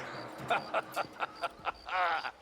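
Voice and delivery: long rolling chuckle; gleeful laugh. Acted. Colonel delighted after calling his love, gleeful laugh. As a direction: laugh on and on, pleased with yourself.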